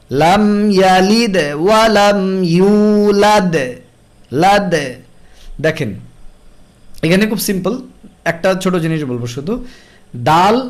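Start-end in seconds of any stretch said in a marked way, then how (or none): 0.1-4.7 ইউলাদ with a bouncing echo on the final দ; echoing qalqala. Shown as a demonstration. Tajweed teacher showing qalqala: slow recitation with an echoing দ. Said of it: "এখানেও দালে কলকলা".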